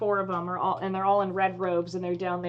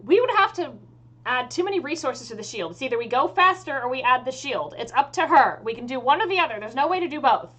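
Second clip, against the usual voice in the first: higher, louder, fed-up elf mage; exasperated; 4 semitones higher, 6 dB louder than usual. Acted. Elf mage tells the captain's envoy the limits, exasperated. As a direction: push back in exasperation, pitched up. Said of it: "sighs very exasperatedly and is just like".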